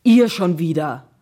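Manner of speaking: loud, slow, gruff outburst; blustering. A narrator's reading. Watchman seeing the boys return, blustering. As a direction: bellow it at unwelcome returning kids. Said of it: "polterte der Wachmann los".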